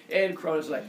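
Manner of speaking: ordinary talk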